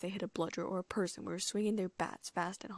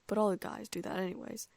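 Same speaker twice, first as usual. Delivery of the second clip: plain reading voice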